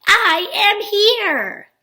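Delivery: high, very slow excited read; excited exclamation. Shown as a demonstration. Teacher reading so children hear the exclamation point, excited. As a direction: read it as a big, drawn-out exclamation. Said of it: "listen to how I'm reading".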